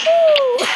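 high loud cheering woo; whoop. Real, not acted. Gamer cheering as the night goes well. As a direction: shout a big happy woo.

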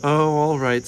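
reluctant, irritated concession; disgusted with himself. A narrator's reading. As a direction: give in with mild exasperation. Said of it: "disgusted with himself for being so soft-hearted".

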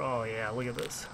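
soft, low, hushed admiration; hushed murmur. Real, not acted. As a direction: murmur it under your breath. Quiet murmur while tasting dip.

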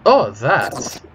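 put-on feigned puzzlement in a thick accent; evasive foreign-accented character. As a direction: play innocent, stretching the questions slowly. Voices a game character.

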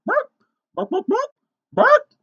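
very high, loud hen squawks; hammed-up chicken "bawk"; dramatic chicken clucking. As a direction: cluck out short bursts like an excited hen. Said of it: "She read with dramatic expression".

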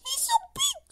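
very high whispered hen voice; airy, very high hen squawk. A narrator's reading. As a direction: squeak it out like a startled hen. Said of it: "squawked the hen".

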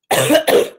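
harsh sick cough; coughing fit, actually sick; real cough. Real, not acted. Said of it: "That's easy since I'm actually sick".

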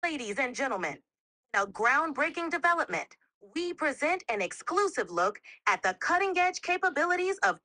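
high-pitched, booming news anchor hype; big bravado announcer. Shown as a demonstration. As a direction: sell it like breaking news of the century. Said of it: "More bravado, and louder, and a little faster".

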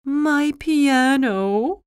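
slow, high, mournful lament; very sad. A narrator's reading. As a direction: draw it out plaintively, high. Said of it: "Schroeder was very sad".

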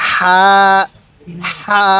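long level হা on an audible breath; airy হা. Shown as a demonstration. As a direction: say হা with a light stream of air from the mouth. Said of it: "মুখ দিয়ে হালকা বাতাস বের হবে".

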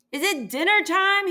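high, loud bellow. A narrator's reading. Sheep bellowing the question down the hill, loud. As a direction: bellow it up the hillside. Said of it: "he bellowed".

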